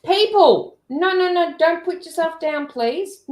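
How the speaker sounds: protest: no, no, no; high pleading protest. Real, not acted. Scolding friend for self-deprecation.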